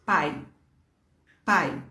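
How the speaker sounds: teacher modelling pai in one go; demo word. Shown as a demonstration. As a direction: show "pai" in one breath. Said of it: "ó".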